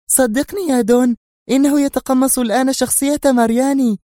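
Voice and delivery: high, loud woman's voice cutting in; very nervous Sonia. A narrator's reading. Sonia cuts off the Don, insisting Adham mimics Mariani. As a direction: interrupt him, frantic and sure. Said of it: "قاطعته في عصبية بالغة".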